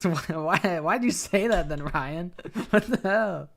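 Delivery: loud, high, incredulous laughter through the words; laughing out loud in disbelief. Real, not acted. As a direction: crack up while demanding an explanation. Cracking up at game dialogue.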